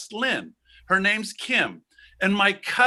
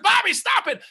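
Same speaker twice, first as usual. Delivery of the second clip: very high mock yelp; panicked protest; 4 dB louder than usual. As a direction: cry out to be let go. Acted protest during the fight.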